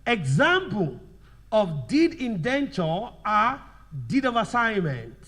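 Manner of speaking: slow, forceful, broken into short chunks; slow dictation. Shown as a demonstration. Teacher dictating an example to students, slow dictation. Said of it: "So let's write".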